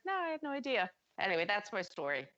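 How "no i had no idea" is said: higher, guileless answer; innocent reply. Retold or imitated. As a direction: play the clueless assistant. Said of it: "she just like".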